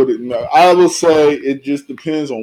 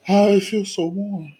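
mock childish voice; 5 semitones higher, 5 dB quieter than usual.

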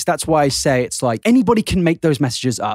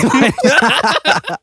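mock YouTuber outrage; 13 semitones higher, 4 dB louder than usual. Mocking the YouTuber's video reply.

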